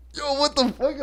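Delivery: high-pitched retold shock; acted disbelief. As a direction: squeal it in mock outrage. Acting out his shock at guy.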